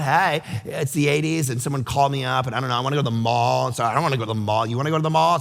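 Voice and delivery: lightly voiced Valley Girl, low and rattled off. Shown as a demonstration. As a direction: run on quickly like an eighties Valley Girl. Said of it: "do that Valley Girl thing".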